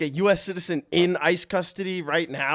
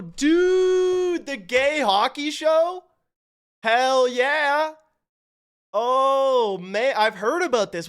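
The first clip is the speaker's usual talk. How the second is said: excited; 7 semitones higher, 5 dB louder, much slower than usual. Real, not acted. Excited about new hockey show.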